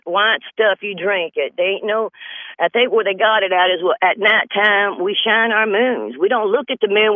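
ordinary delivery

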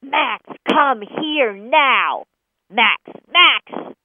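very loud, high yell at the dog; angry yell. Shown as a demonstration. Owner shows how not to call the dog, angry yelling. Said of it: "Don't yell".

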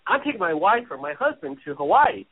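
usual speaking voice

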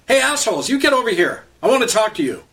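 put-on old man yells at cops to come over; angry yell. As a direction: shout him down from across the road. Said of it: "he angrily yelled across the street".

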